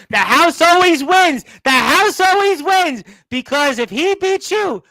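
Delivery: loud, high-pitched wrestling announcer's outburst; worked-up shouting. Real, not acted. Host reacting to the champion's last word, worked-up shouting.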